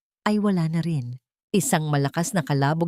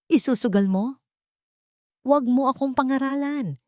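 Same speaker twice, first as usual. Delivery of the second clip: angry retort; 6 semitones higher than usual. A narrator's reading. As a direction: throw the blame back at her. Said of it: "Ganti ni Elsa".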